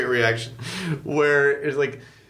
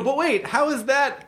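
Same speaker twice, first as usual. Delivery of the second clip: mock student question; 10 semitones higher than usual. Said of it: "they go".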